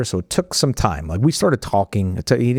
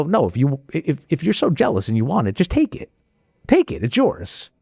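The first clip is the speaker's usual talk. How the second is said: insistent urging; 6 semitones higher than usual. Retold or imitated. Son insisting his father keep the truck, high urging. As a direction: pitch soaring up on take it.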